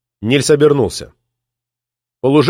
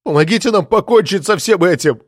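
pleading cry; 5 semitones higher than usual. A narrator's reading. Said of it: "умоляюще закричал".

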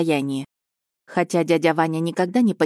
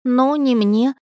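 put-on higher boyish voice, faltering; hesitant boy; 7 semitones higher, 4 dB louder, slower than usual. Nephew caught out, hesitating in a higher boyish voice. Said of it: "замялся Алёша".

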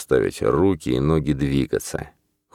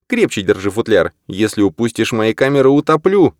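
warning; 6 semitones higher, 3 dB louder than usual. Acted. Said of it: "ещё раз предостерёг великана".

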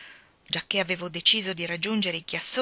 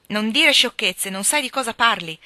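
grave rebuke; 3 semitones higher, 8 dB louder than usual. Acted. Walter rebukes his teasing sister, grave and stern. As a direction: shut her down gravely. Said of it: "con aria grave".

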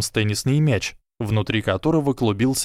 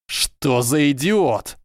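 slow, drawn-out hiss of disbelief; hissed exasperation; 4 semitones higher, much slower than usual. A narrator's reading. Draco reacts to Goyle flying off, hissed exasperation. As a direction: hiss it slowly at a fool's stunt. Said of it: "прошипел Драко".

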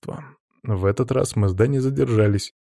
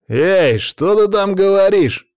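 high, loud, slow furious shout; loud demanding shout; 10 semitones higher, 7 dB louder, slower than usual. A narrator's reading. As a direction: yell a challenge across the room. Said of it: "крикнул ей некромант".

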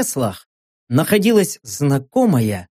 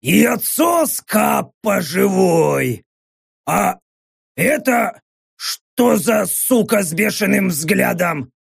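droning orc; 3 semitones higher, slower than usual. A narrator's reading. Same orc goes on talking.

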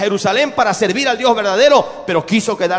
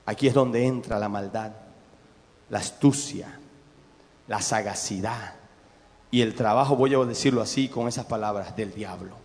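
hushed and ominous; 8 semitones lower, 6 dB quieter than usual. Acted. Preacher revealing the devil's cunning, hushed and low. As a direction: let it sink, quiet and dark. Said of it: "preacher drops voice on the devil's cunning".

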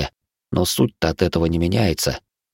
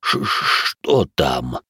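slow, low, scared hush; frightened whisper; 5 semitones lower, much slower than usual. Acted. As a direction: whisper it in fear, drawn out. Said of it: "испуганно прошептал Иоши".

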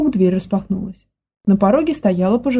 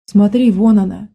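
ordinary delivery, nothing marked

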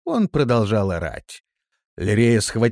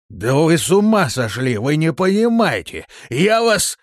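loud outburst climbing higher to a cut-off threat; indignant and louder by the word; outraged protest; 8 semitones higher, 7 dB louder than usual. Acted. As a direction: shout in disbelief, rising until interrupted. Man threatens the demons.

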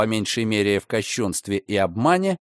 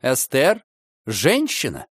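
exclaiming; slower than usual. A narrator's reading. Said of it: "воскликнул автор маргариток".

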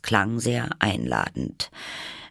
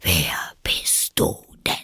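contemptuous caterpillar; 4 semitones lower than usual. A narrator's reading. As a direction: breathe it out with lazy scorn. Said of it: "sagte die Raupe verächtlich". (verächtlich, tief und langsam gehaucht)

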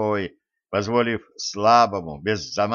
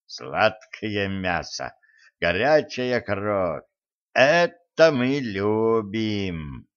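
hungry spirit; much slower than usual. Acted. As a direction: relish every word like a ghoul. Spirit savours the warriors.

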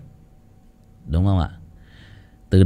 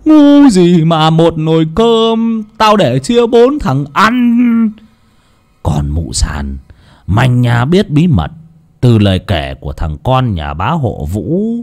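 gloating villain; 3 semitones higher, 6 dB louder, slower than usual. Acted. Lão Tuân confessing to dying Thầy Nghiêm, gloating and menacing. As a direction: relish the confession, bursting into loud high outbursts.

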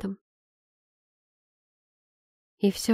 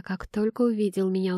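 usual speaking voice

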